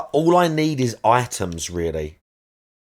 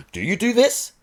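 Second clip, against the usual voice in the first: "this?" sweeps up high at the end; rising inflection; 8 semitones higher, 4 dB quieter than usual. Shown as a demonstration. Showing chat what upspeak sounds like, rising. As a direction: demo uptalk, the word "this" climbing high. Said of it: "it goes up at the end".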